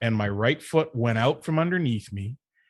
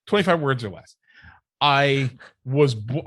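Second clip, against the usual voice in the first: ordinary talk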